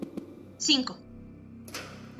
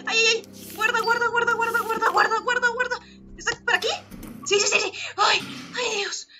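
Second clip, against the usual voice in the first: higher, breathless anxious urging; nervous panic; 7 semitones higher than usual. Real, not acted. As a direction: keep fretting after the scare. Still panicking after chase. (nerviosa y asustada)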